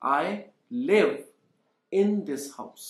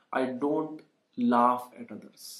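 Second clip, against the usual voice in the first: very slow, word by word for learners; teacher spelling out the sentence for the class, very slow; slow model sentence; 5 semitones lower, 4 dB quieter, much slower than usual. Shown as a demonstration. Teacher models answer slowly.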